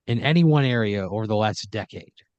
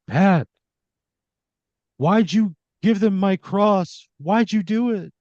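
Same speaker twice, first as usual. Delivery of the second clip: high, slow ghostly plea; a hammed-up voice of Jesus from the tree, drawn out; eerie wind voice, mock divine; Christ's voice on the wind; 8 semitones higher, 5 dB louder, slower than usual. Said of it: "you hear his voice go".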